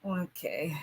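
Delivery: soft whispered okay; breathy little okay under the breath; whispered aside. Real, not acted. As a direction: whisper it to yourself. Aside while reading chat.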